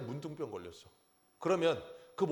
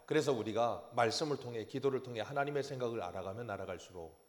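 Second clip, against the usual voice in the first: normal talking voice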